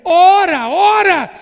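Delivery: loud, high, slow preacher shout; preaching shout. Real, not acted. Preacher warning the church about family gossip, shouting. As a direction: thunder the warning at the congregation.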